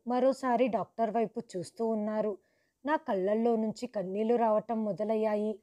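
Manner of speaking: ordinary delivery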